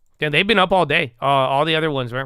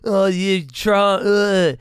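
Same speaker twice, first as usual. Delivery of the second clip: raised, slow whiny mock-viewer voice; 6 semitones higher, 4 dB louder, slower than usual. Streamer mimics scolding viewers in a higher, drawn-out whine. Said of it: "you guys are like".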